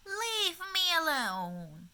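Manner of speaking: high, loud, slow grumpy yell; shouted. Acted. Old woman yelling at her grandchildren, loud and high. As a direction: bellow it back at the whole family. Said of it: "she shouted back".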